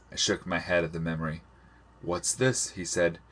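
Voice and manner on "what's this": plain reading voice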